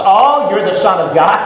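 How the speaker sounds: sneering with a scornful laugh; mocking laugh. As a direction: laugh at him like a jeering crowd. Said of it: "they continue to throw those insults".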